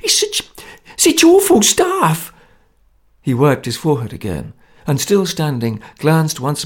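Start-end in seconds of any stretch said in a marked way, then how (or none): 0.0-2.3 high, loud squeaky pleading, slowing at the end; a nervous man squeaking in fear; frightened squeak. A narrator's reading. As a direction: squeak out a terrified warning. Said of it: "he squeaked under his breath".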